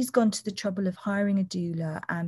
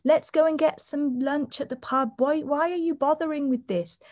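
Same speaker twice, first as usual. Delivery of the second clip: mock tempting bystander; 7 semitones higher than usual. Bystander tempting a marathon runner to quit, mock sweet coaxing. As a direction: coax the runner to quit, tongue in cheek.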